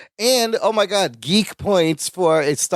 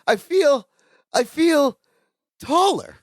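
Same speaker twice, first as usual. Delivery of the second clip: 1 Larry, high; 8 semitones higher, slower than usual. Retold or imitated. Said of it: "And then he goes".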